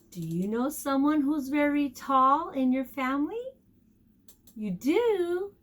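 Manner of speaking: ordinary delivery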